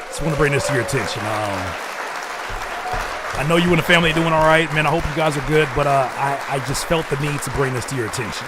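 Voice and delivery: loud, fast, overly earnest concerned friend; mock concerned DM. Streamer mocking a dreaded concerned DM, loud and fast. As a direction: ham up the worried polite message. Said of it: "DMs terrify me".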